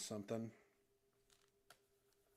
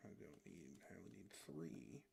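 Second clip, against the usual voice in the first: ordinary delivery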